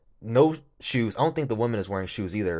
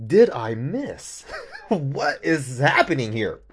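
baffled disbelief; 5 semitones higher, 3 dB louder, slower than usual. Retold or imitated. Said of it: "I was sitting there like".

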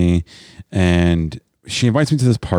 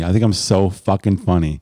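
ordinary talk, nothing marked

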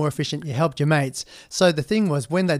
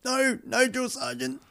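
scared recruit; 9 semitones higher, 6 dB quieter, slower than usual. Retold or imitated.

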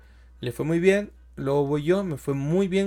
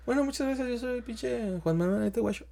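deflated sign-off; 6 semitones higher, 8 dB quieter than usual. Retold or imitated. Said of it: "de decir".

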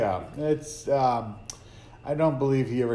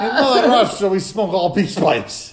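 put-on Russian accent; 5 semitones higher, 5 dB louder than usual. Mock Russian boast.

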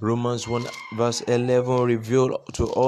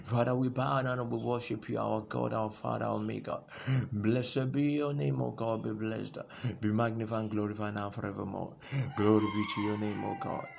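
prayer; 6 dB quieter than usual. Acted. Pastor praying over his listeners, soft and reverent. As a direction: pray quietly before the congregation.

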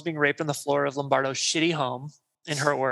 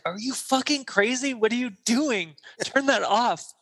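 higher, alarmed angry demands; panicked outburst; 7 semitones higher than usual. Retold or imitated. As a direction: burst in shouting at the police. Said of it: "He's like".

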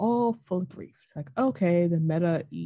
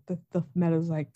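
usual speaking voice

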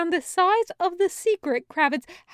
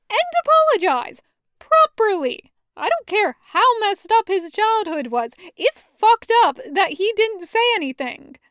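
frowning demand; 6 semitones higher, 3 dB louder than usual. A narrator's reading. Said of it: "frowning at Barry".

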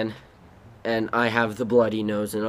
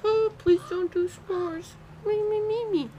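high pleading. Retold or imitated. Child begging in a retold scene.

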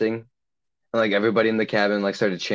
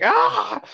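imitated scream. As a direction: let out a short, held-back imitation shriek. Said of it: "all you heard was a scream".

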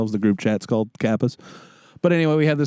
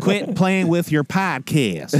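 higher, slow Southern scolding; mock Louisiana listener; 5 semitones higher, slower than usual. Parent scolding a podcast listener, slow mock Southern accent. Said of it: "four people from Louisiana that listen".

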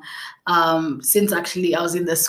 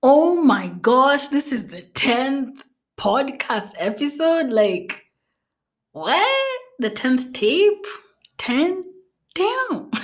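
high-pitched, drawn-out mock astonishment; amazed disbelief; 6 semitones higher, slower than usual. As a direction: react as if stunned by your own milestone, slowly rising higher. Acting out own amazed thoughts.

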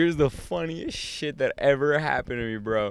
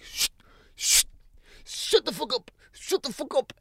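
high hushed mock scold; dad hushing. Dad hushing his laughing kids, high, hushed scold. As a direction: shush the kids hard.

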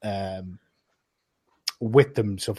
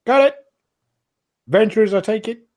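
high, loud barmaid voice; innkeeper greeting; 11 semitones higher, 6 dB louder, faster than usual. Acted. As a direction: size up the adventurers.

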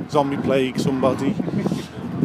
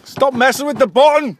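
loud; 9 semitones higher, 5 dB louder than usual. Real, not acted. Telling someone off on ride.

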